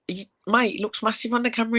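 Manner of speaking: very high squeaky disbelief; mock shocked high voice. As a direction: squeal it like you can't believe your eyes. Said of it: "And I was like".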